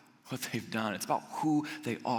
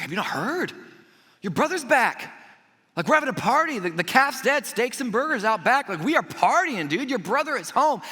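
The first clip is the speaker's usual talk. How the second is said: high, louder party news; excited servant; 8 semitones higher, 5 dB louder than usual. Retold or imitated. Servant telling the older brother, loud excited news. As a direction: tell the brother the party's on, thrilled. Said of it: "the servant's like".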